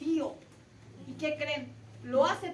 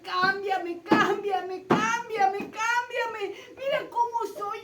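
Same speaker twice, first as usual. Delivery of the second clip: loud, higher, breaking into tears; tearful begging; 6 semitones higher, 7 dB louder, much slower than usual. Retold or imitated. Jacob crying out to God at dawn to change him. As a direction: beg until the voice cracks.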